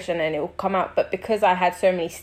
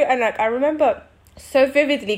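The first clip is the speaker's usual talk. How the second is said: ordinary talk, nothing marked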